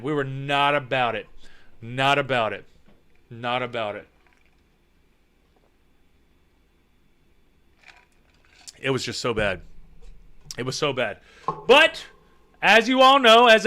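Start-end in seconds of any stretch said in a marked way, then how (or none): 0.0-11.2 low, soft, drained repetition; subdued, fading. Real, not acted. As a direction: let it sink away, with pauses between. Winds down after the scary story.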